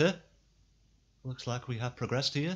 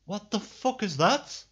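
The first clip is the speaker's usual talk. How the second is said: higher, slow, rattled; shaken; 8 semitones higher, slower than usual. Real, not acted. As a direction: still shaken after a scare. After the jump scare.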